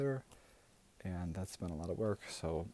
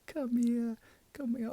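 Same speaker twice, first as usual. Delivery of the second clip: high, eager come-here call; excited calling; 14 semitones higher, 5 dB louder, slower than usual. Acted. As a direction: call the kid over with delight. Auntie calling the child over.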